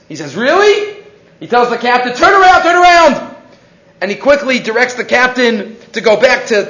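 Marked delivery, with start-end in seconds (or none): 0.3-1.0 high, startled really; student suddenly amazed by the angels. Retold or imitated. 2.1-3.3 high, loud urgent order; student urging the captain to turn the boat. Retold or imitated.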